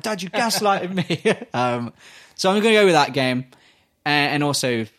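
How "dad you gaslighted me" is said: high, slow, drawn-out accusation; playfully indignant, stretching the words; mock outrage. Grown child accusing dad over the game, mock outrage.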